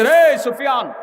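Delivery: acted harsh rebuke with sharp high bursts; stern scolding shout. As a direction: bark the name, then scold and order him away. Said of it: "কঠিন হুংকার দিয়ে ধমক দিয়ে".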